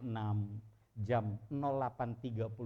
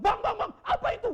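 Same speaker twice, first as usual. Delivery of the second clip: high, loud startled calling; frightened cry; 11 dB louder, faster than usual. Retold or imitated. Sister seeing the patient move, frightened high cry. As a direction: cry out in scared surprise. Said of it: "langsung bilang".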